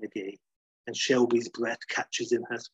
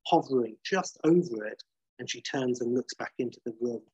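normal talking voice

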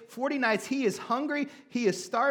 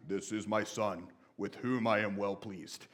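deep, slow booming voice of God from the heavens; 11 semitones lower, 5 dB quieter, slower than usual. Retold or imitated. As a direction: drop way lower and slow it down like God speaking from the sky. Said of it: "the kids are a lot more impressed by my God voice".

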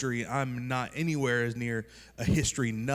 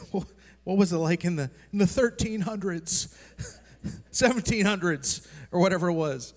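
higher, louder mock wail, breaking into a laugh; hammed-up teary lament over the castle; imitating tearful wife; 7 semitones higher, 4 dB louder than usual. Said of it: "She's like".

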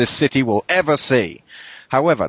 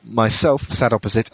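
usual speaking voice

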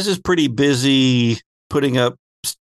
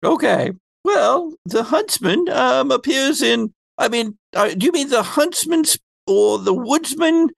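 higher, swooping lecturing voice; Phoebe; 3 semitones higher than usual. Acted. Phoebe explains Huntsman and Woodsman in her affected voice. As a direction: explain with big swings in pitch, in character. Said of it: "my Phoebe voice is this".

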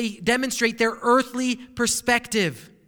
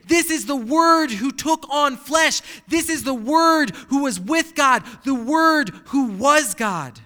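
louder, slow, proclaiming each phrase; preaching at full force; 4 semitones higher, 5 dB louder than usual. Acted. Preacher proclaiming Christ as the Word to his congregation, loud and slow. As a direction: lift it up like the sermon's peak.

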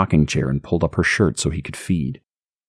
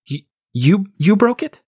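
high, stammering shocked question; can't believe he did it; stunned disbelief. Acted. Stacy stunned at Harry.

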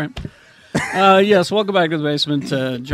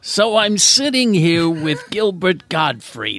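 put-on slow, over-enunciated old showman; a light Stan Lee impression. Shown as a demonstration. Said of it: "can you go from Stan to Gilbert".

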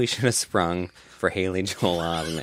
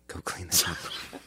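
mocking unvoiced whisper; 3 dB quieter than usual. Manager repeats in whisper.

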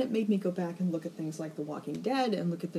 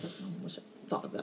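hushed whispered inner thought; whispered aside; 12 dB quieter than usual. Retold or imitated. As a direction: whisper it to yourself, envious. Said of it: "I'm like".

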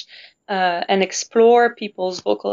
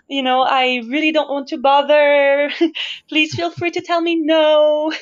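raised, people-pleasing sweet voice; small apologetic voice pitched up high; high nice voice; 5 semitones higher than usual. Retold or imitated. Singer mocking her old timid self, in a high sweet voice. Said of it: "in this higher position of trying to be nice".

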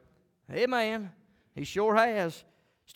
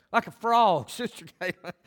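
ordinary delivery

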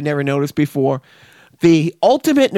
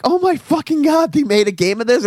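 amazed; 10 semitones higher than usual. Retold or imitated. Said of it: "I'm like".

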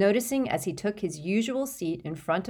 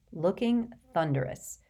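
usual speaking voice

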